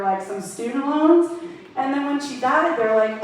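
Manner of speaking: normal talking voice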